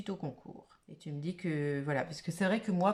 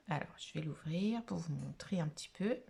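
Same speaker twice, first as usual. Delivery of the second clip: soft whisper while opening the envelope; whispering to the viewers; 3 semitones lower, 6 dB quieter than usual. Real, not acted. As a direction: hush it, like not to spoil the surprise. Quietly opening a parcel on camera.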